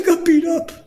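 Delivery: high-pitched mock wail of a little kid; crying child. Six-year-old telling his father about a beating, mock crying. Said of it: "I come home one time crying".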